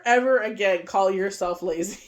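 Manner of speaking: ordinary delivery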